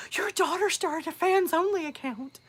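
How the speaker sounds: high, put-on snitching voice; mock-scandalized caller voice; mock tattling caller. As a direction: play the nosy caller reporting her. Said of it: "I could see me doing like".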